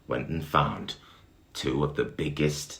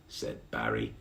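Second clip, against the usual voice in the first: ordinary delivery, nothing marked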